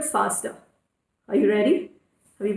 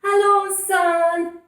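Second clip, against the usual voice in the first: cheerful greeting; 6 semitones higher, 3 dB louder, much slower than usual. Shown as a demonstration. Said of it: "and say".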